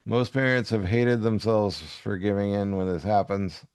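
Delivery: ordinary delivery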